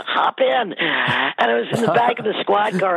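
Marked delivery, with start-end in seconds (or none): 0.0-0.7 imitating cops. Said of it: "they go".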